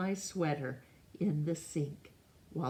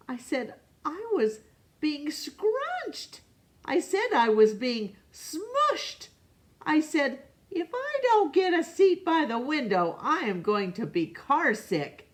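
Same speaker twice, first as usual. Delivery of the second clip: put-on high, louder whining kid voice; whiny child; 11 semitones higher, 4 dB louder than usual. A narrator's reading. Alexander protesting in the carpool, loud high whine.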